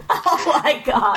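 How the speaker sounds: slow, laughing exclamation. Real, not acted. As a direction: crack up mid-word. Cracking up after botched intro.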